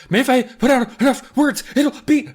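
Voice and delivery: put-on high, loud, panicky kid babble; frantic fast talking. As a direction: imitate a kid talking over an angry parent. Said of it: "you start talking even faster".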